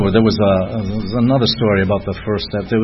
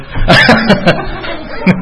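airy, unvoiced laugh; breathy laugh; 8 semitones higher than usual. Real, not acted. Keeps laughing at himself.

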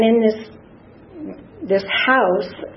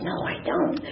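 much softer, whispered answer; whispered reply; 9 dB quieter than usual. Retold or imitated. Tearful newcomer answering the woman beside her, whispered. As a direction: barely breathe the answer back.

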